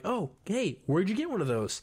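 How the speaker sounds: high, eager question; mock eager Aang. Aang asking the couple about the flower, eager and high. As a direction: perk up high and curious. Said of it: "and he's like".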